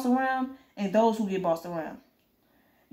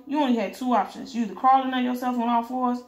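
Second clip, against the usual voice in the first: ordinary delivery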